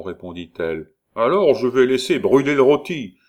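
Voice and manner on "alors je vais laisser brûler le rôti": hoarse man's shout. Acted. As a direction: call out loudly to someone in another room. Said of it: "cria la voix rauque". (cri d'une voix rauque)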